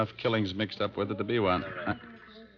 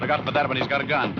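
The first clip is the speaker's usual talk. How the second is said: raised, higher urgent warning; 6 semitones higher, 3 dB louder than usual. Acted. Detective warning his partner of an armed man, urgent raised voice. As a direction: warn him fast, the gunman's right there. Fight breaks out on deck.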